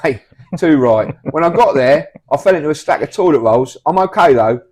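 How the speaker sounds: loud eager anecdote; loud storytelling. Acted. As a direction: tell it loudly and boldly. Loud toilet paper story.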